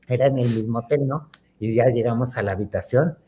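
usual speaking voice

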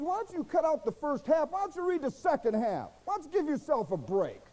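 high, insistent retold challenge; exasperated retort. Retelling his retort to angry objectors.

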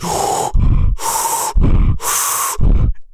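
big loud unvoiced inhale; loud breathing gasp. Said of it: "It goes".

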